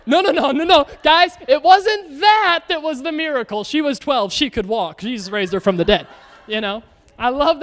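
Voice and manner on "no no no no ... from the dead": high, louder mock exasperation; exasperated explaining. Writers correcting the congregation, hammed-up exasperation. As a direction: set them straight, no no no. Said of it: "They were like".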